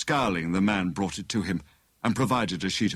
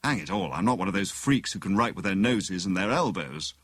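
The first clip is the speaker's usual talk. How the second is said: usual speaking voice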